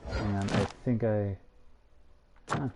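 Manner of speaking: usual speaking voice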